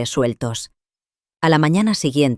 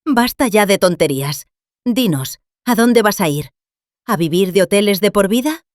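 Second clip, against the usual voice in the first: raised voice; 5 semitones higher than usual. Acted. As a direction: drop the act and raise your voice at her. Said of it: "alzó la voz".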